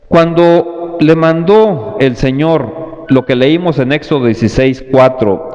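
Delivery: ordinary talk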